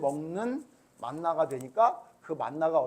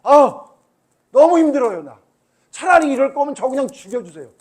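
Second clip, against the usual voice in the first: higher, louder despairing plea; Moses despairing; 7 semitones higher, 13 dB louder than usual. Retold or imitated. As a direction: beg to be let go, worn out. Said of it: "모세가 심지어".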